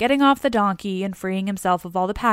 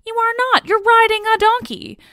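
high, loud indignant contradiction; indignant retort; 13 semitones higher, 7 dB louder than usual. Acted. Dionysus bickering with his slave over the donkey, indignant. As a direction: throw it back loudly, pitched way up. Acted master and slave quarrel.

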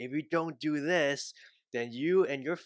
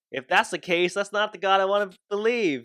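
higher, louder protest; indignant outburst; 6 semitones higher, 7 dB louder than usual. Real, not acted. Man rejecting the billboard's God with a higher, louder outburst. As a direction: push back hard on that God. Outraged at religious threats.

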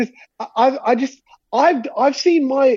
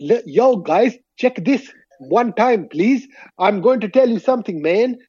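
slow, badly faked Cockney accent; halting, put-on bad Cockney. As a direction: imitate a foreigner attempting Cockney. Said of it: "speak in a really bad Cockney accent".

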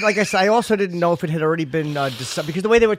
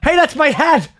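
ordinary talk, nothing marked

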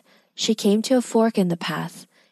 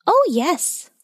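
high, squeaky bird reply; 10 semitones higher than usual. A narrator's reading. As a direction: answer as a tiny talking bird. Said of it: "replied the bird".

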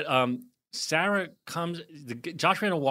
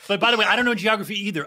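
rushed confession; 6 semitones higher, 7 dB louder, faster than usual. Real, not acted. Quick self-deprecating admission.

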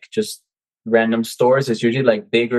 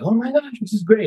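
high, gushing mock delight; imitating a student who can't believe it worked; imitating amazed students; 12 semitones higher than usual. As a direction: play the thrilled student. Students' amazed reaction, acted.

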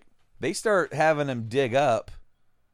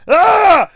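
high, very loud scream; acted shriek of a man getting bitten; sudden full-voiced yell of pain; mock scream; 20 dB louder than usual. Acts out man being bitten.

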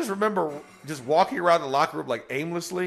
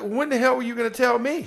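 high-pitched mock indignation; retold outrage; 9 semitones higher than usual. Player just learning he's starting, mock-outraged. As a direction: play up the exasperated disbelief. Acted disbelief at surprise news.

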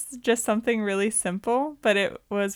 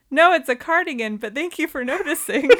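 imitated obliviousness; 6 semitones higher, 4 dB louder than usual. Quoting Harry's cheery misunderstanding.

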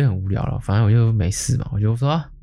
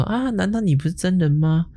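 lightly mocking, higher, feigned innocence; a touch of coy fake innocence; mock girly voice; 8 semitones higher than usual. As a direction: play a coy fake woman asking back. Scammer feigning innocent surprise.

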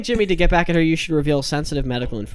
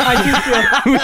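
laughing; 10 semitones higher, 5 dB louder, much slower than usual. Real, not acted.

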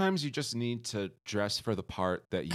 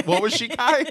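laughing; 12 semitones higher, 9 dB louder than usual. Real, not acted. Teasing, high squeal laughing.

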